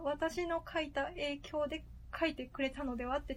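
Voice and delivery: usual speaking voice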